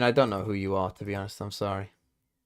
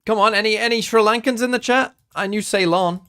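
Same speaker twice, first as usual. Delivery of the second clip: much higher and louder, hailing the viewers; calling out to chat; 10 semitones higher, 8 dB louder than usual. Real, not acted. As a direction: shout the question out to the chat.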